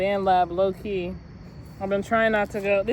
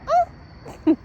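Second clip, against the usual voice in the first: very high, loud squealing oh; mock sexual moan from her songs; squealed moan; 4 dB louder than usual. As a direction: let out a sudden high-pitched moan. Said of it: "I'd be like".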